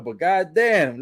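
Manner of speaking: ordinary talk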